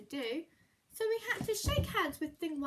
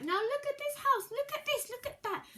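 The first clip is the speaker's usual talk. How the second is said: high, loud indignant scold; scolding fish; 12 semitones higher, 3 dB louder than usual. Acted. Fish scolding the cat about the wrecked house, high indignant. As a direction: tell the cat off.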